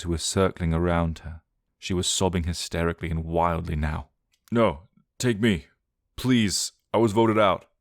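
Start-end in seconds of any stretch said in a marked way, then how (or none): 4.5-7.7 panting plea. Acted. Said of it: "Johnny panted loudly".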